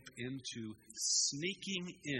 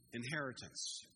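ordinary delivery

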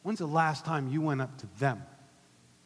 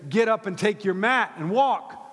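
loud, high proclamation; soaring, shouted sermon delivery; full-force preaching; 10 semitones higher, 11 dB louder than usual. Real, not acted. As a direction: proclaim it to the whole congregation.

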